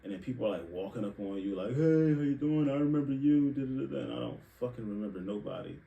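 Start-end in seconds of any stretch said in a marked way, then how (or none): 1.7-4.0 higher, louder overeager greeting; mock friendly relative. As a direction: mimic a too-friendly stranger. Strangers greeting at family reunions.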